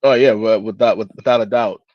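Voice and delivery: ordinary delivery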